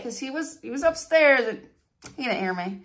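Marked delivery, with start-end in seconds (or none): none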